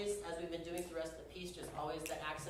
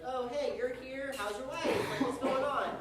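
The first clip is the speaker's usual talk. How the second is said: higher, slow, chummy hello to a neighbour; a light sketch of a cheery villager; friendly shepherd greeting; 7 semitones higher, 5 dB louder than usual. Pretend. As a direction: greet an old friend across the hills. Shepherds greeting each other, imagined.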